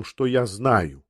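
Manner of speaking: ordinary delivery, nothing marked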